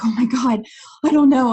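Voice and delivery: loud, high mock exasperation; retold freak-out. Coach telling a friend the truth, loud retold freak-out. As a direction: blurt it out loudly, imitating past panic.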